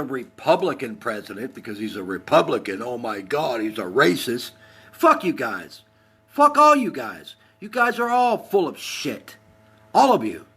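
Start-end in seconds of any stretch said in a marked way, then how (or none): 4.9-10.5 real high-pitched angry outburst; worked up. Real, not acted. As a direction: lash out at them all, voice pitched up. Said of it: "I'm getting a little worked up".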